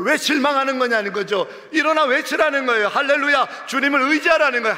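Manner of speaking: preacher at full force; fiery preaching. Acted. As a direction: rouse the church to shout hallelujah.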